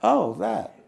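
high, loud burst of recognition; a laughing oh of recognition; surprised laugh. Real, not acted. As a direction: caught out, laughing. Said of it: "laughing reaction".

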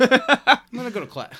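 high, loud mock of Elroy bailing out; mock Elroy exit. Cohost echoes Elroy's exit line, high and loud mock. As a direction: squeak it out like a guy fleeing awkwardly. Mimicking Elroy leaving the meeting.

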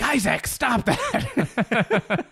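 high, very slow whiny chiding; hammed-up friends telling him off; mock scolding friends. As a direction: drag it out like a nagging friend. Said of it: "my friends are all like".

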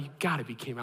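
low under-the-breath disbelief; muttered exasperation. Retold or imitated. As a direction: say it to yourself, defeated.

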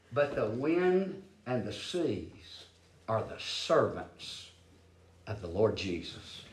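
very soft, slow and low; hushed reverence. Real, not acted. Preacher telling congregation who the seas serve, hushed and slow. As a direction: let it sink to a hush, word by word. Sermon drops to hushed, awed close.

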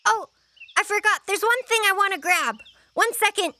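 put-on high, hurried kid; child remembering suddenly. Child dashing off to grab something, hurried. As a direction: blurt it high and quick.

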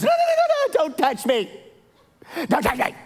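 high, loud frightened plea; panicked daughter. Retold or imitated. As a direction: beg them not to touch the sore ear. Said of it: "She goes".

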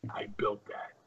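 a proud whispered boast. Retold or imitated. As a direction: breathe it like a secret. Jimmy's proud confidence.

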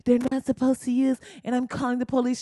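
high-pitched mock complainer; Barbecue Becky. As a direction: pitch it up like a fussy caller. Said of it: "now she wanna use a regular tone".

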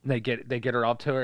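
ordinary delivery

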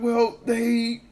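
slow, high, protesting imitation; coworker's defensive retort. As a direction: mimic a coworker arguing back, high and loud. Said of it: "He's like".

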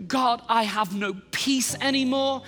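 high, impassioned pleading; anguished, emphatic cry to God; desperate prayer. Retold or imitated. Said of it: "my prayer was this".

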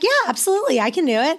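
lightly re-enacted eagerness, jumping up high; eager agreement. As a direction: jump in high and keen to say yes. Eagerly accepting in retold exchange.

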